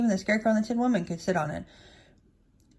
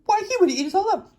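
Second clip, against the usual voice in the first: high, loud mouse queen protest; alarmed cry; 8 semitones higher, 6 dB louder than usual. A narrator's reading. Queen fearing she'll be eaten, alarmed cry. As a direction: exclaim it high in mock alarm. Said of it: "cried the queen".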